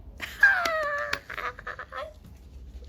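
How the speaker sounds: high squealing giggle; shrieking laugh. Real, not acted. Vlogger giggling after acting out a drama scene.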